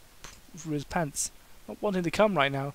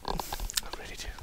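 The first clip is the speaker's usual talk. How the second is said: breathy sly whisper; 8 dB quieter than usual. Acted. Kane confessing his wish to Daniel, whispered. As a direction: whisper it wistfully.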